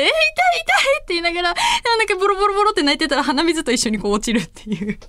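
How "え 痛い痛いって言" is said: high, imitated sobbing cry of pain; mock tearful wail. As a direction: replay crying along with your sister. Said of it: "一緒に泣き出しちゃって". (泣きまねの高い声で)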